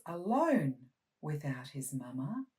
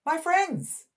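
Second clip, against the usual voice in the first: fish voice; 13 semitones higher, 7 dB louder than usual. Acted. Fish speaks at last.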